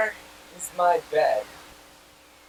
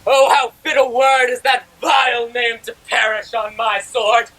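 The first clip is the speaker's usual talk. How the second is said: furious, loud and forceful; angry shout; 8 dB louder than usual. Acted. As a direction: rage at the rival's name. Lysander rages at Demetrius' name.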